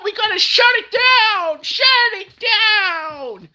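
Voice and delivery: mock panic. Mocking panicked officials.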